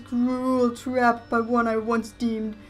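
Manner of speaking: high, loud, slow spectral wail; ghost voice. Acted. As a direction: moan it like a wronged ghost. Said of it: "Okay, ghost voice".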